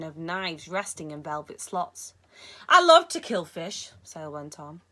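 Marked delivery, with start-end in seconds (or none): 2.7-3.9 put-on loud, high Cairo-accented relish. A narrator's reading. Sale tells Alex what he loves, Cairo accent. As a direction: savour the killing in a Cairo accent. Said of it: "He had a heavy accent brought with him from the Cairo marketplace".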